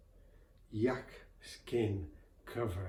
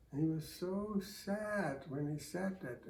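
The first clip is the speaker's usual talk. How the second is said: ordinary delivery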